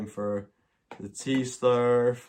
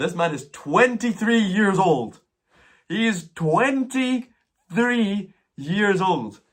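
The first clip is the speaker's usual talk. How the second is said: "twenty-three" drawn out, very slow and higher; stress on twenty-three; 9 semitones higher, 5 dB louder, much slower than usual. Real, not acted. Vlogger insisting to viewers how young the man is, slow emphatic stress. Stressing the Highland man's age.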